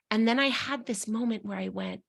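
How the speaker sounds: ordinary talk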